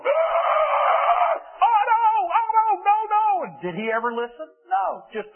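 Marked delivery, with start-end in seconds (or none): 0.0-3.2 high, loud frantic yell; panicked shout. Retold or imitated. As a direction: scream after the runaway dog. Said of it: "and I'm going".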